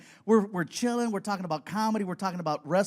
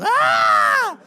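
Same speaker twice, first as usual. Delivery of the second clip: high loud straining yell with a laugh; pushing yell; 12 dB louder than usual. Retold or imitated. Guest rushing on the toilet, high straining yell. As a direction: yell it out like a desperate push.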